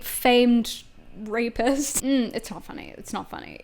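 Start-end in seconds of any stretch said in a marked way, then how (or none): 2.3-3.6 whisper. Acted. Whispered self-scold.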